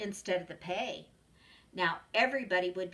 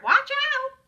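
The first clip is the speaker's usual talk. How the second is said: very high, loud parrot screech; 14 dB louder than usual. A narrator's reading. Parrot warning the puppy, loud high screech. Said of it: "screeched the parrot".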